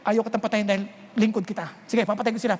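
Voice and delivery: calm God. Retold or imitated. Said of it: "Parang sabi ng Lord".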